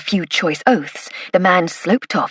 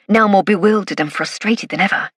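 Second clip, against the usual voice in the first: usual speaking voice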